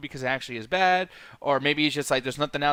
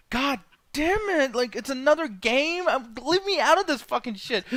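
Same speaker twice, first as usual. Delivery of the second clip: higher, slow, exasperated groan of a weary hero; frustrated Ethan; 11 semitones higher, slower than usual. Retold or imitated. Gamer imitating Ethan's weary frustration, higher and slow. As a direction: drag it out like a man sick of it all. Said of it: "there's a frustration in his depression".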